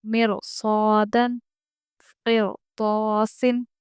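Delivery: slow इरजिई and फिरकतुन with a full ra; heavy ra recitation. Shown as a demonstration. Teacher reciting examples slowly with a heavy ra. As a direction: stretch both words, ra thick. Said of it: "फिर रॉ पुर पढ़ेंगे".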